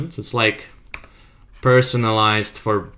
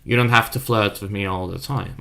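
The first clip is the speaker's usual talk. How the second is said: normal talking voice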